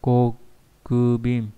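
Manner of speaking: very slow, syllable by syllable; slow model reading. Shown as a demonstration. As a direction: stretch each syllable out for learners. Teacher models the word slowly.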